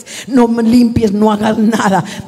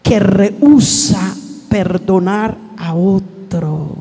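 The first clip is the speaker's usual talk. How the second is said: slow, weighty preaching; much slower than usual. Acted. Pastor warning the church about bitterness, slow solemn preaching. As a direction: lean into every phrase, slow and heavy.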